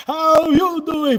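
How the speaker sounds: loud, slow, high greeting; booming friendly hello. Real, not acted. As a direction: stretch the hello out loudly.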